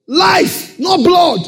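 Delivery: a preacher's ringing shout; shouted climax. Acted. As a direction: shout it out to the congregation. Preacher climaxes the quoted verse.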